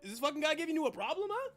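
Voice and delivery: second talking trash can. Acted. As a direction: back up your pal, looking for trouble. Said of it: "You hear".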